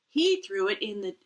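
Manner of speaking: ordinary delivery, nothing marked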